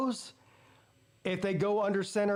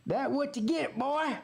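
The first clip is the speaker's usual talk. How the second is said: mocking taunt; 7 semitones higher than usual. Fan taunting the injured quarterback.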